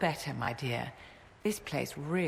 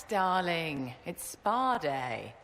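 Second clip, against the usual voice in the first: drawn-out; much slower than usual. Acted. Drawn-out reassurance.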